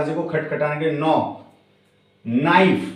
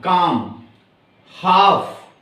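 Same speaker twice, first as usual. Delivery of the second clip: ordinary delivery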